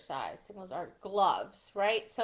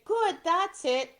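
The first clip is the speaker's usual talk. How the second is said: mild dog encouragement; 7 semitones higher, slower than usual. Shown as a demonstration. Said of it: "or I could say".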